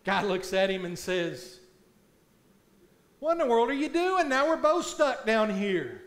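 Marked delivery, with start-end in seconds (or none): 3.2-6.0 loud, high and fast disbelief; stuck man shouts at the friend who jumped in; exasperated shout. Retold or imitated. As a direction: yell at him for jumping in.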